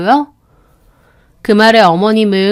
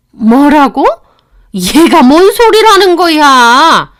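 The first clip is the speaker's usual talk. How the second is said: loud, high, slow disbelief; can't believe what she heard; stunned mother-in-law; 6 semitones higher, 8 dB louder, slower than usual. Acted. (어이없어 소리치는 시어머니)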